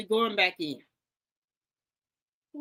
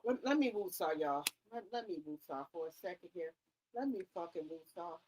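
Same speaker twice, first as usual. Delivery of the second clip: much softer, breathy woosah to settle down; calming herself down; 5 semitones lower, 9 dB quieter than usual. Real, not acted. Laughing too hard during the interview, she softly woosahs herself calm. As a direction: talk yourself down, barely audible. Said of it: "I gotta take a deep breath".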